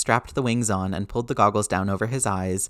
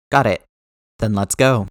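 loud; 7 dB louder than usual. Acted. Loud battle-ready replies.

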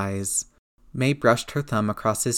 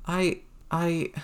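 voice cracking; 7 semitones higher than usual. A narrator's reading. Boy choking up as he tells aunt. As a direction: voice cracks on the words. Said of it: "Peter's voice cracked".

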